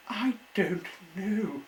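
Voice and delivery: high, slow, small worried voice; a frightened little engine. Acted. The engine answers Peachy, high and worried. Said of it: "in a worried voice".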